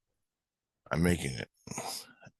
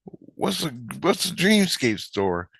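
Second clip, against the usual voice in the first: high, slow, halting question; puzzled retold question; 8 semitones higher, slower than usual. Train maker asking the kid, high puzzled question. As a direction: ask it pitched up and puzzled. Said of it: "And I go".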